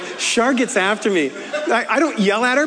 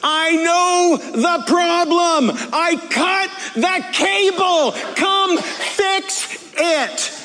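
furious slow yelling; 10 semitones higher, much slower than usual. Acted. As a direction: spell it out slowly at the top of your voice. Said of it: "And so this is me".

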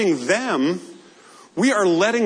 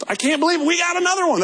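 excited; 12 semitones higher, faster than usual. Pretend. Preacher mimics camp leader.

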